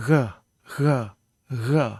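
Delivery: "g" fricativo, língua sem tocar o palato; "g" soprado, sem fechar; fricative g. Shown as a demonstration. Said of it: "didn't put your tongue completely".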